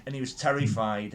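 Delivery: ordinary talk, nothing marked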